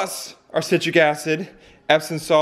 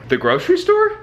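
surprised; 13 semitones higher than usual. Real, not acted. Surprised echo of the destination.

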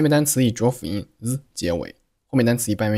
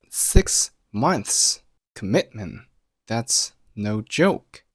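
"Six months commitment" one heavy word at a time; very slow, stressed "that's no joke"; slow emphatic stress; much slower than usual. Shown as a demonstration. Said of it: "咱们放慢语速".

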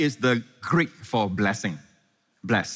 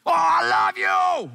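high, slow, loud shouted declaration; yelled love; 3 dB louder, slower than usual. Pretend. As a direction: shout it across the garden to your lover. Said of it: "Lovers don't yell".

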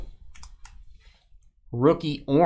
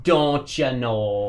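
slow, drawn-out Canadian "don't you know"; teasing fake-Canadian catchphrase; mock Canadian accent; much slower than usual. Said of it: "in Canada".

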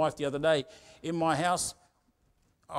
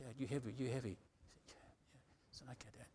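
very soft, barely voiced; hushed retelling; 3 semitones lower, 17 dB quieter than usual. Son checking on his crying father, hushed. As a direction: drop to almost a whisper.

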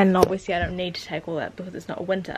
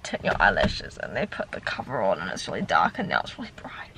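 hushed whisper; 13 semitones lower, 6 dB quieter than usual. Real, not acted. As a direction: whisper it like a secret. Secret-sounding aside about eyelashes.

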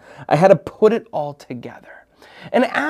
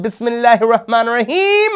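high, eager acted invocation; hopeful invocation; 8 semitones higher, 3 dB louder, faster than usual. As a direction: recite it in rising anticipation. First hopeful invocation before pressing button.

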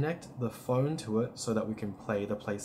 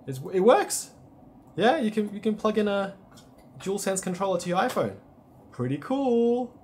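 excited; 10 semitones higher than usual. Real, not acted. Phone controller finally works.

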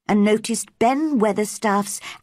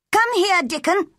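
put-on high-pitched boy calling out an order; furious boy's shout; 12 semitones higher than usual. A narrator's reading. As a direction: summon your friend angrily, voice pitched high. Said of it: "he shouted".

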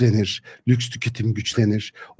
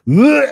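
loud, much higher mock retch; mock vomiting; 13 semitones higher, 7 dB louder than usual. Preacher mocking a European vomiting ideas onto the table, loud retch. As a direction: hurl out a disgusted vomiting sound.

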